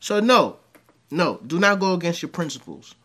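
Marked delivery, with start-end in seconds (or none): none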